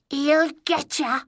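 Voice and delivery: high, slow goblin squeak. A narrator's reading. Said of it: "he squeaked".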